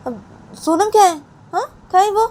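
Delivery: high, loud alarmed question; startled mother. Acted. Payal finding Sonam gone, high and loud. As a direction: demand where the child went.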